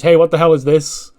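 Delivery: loud, outraged question; indignant challenge. Acted. Man confronting team with crumpled poster, indignant. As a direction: demand an explanation, raising the voice.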